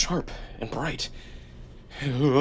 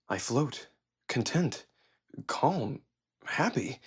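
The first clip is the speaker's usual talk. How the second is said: much softer, floaty murmur; hushed, contented drift; dreamy softness; 10 dB quieter, much slower than usual. Acted. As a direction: drift through the words.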